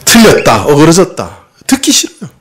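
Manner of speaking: ordinary talk, nothing marked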